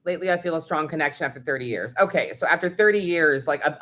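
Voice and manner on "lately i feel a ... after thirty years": quick, softer, matter-of-fact phrasing; plain question. Shown as a demonstration. Demoing a better question to ask the reader, quick and light. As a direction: rattle it off as a neutral example question. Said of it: "So a better reading would be like".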